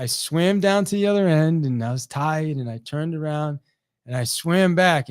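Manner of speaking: normal talking voice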